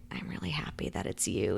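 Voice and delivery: soft, unvoiced whisper; hushed, intimate acted aside; imitated whispered confidence. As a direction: whisper it as a private confession. Royce confides as they leave.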